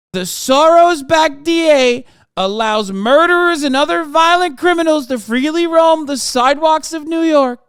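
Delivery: high, loud mock Trump rant; Trump impression. Streamer reads out Trump's post in a loud mocking Trump voice. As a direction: ham up a pompous Trump quote. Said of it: "so Trump says".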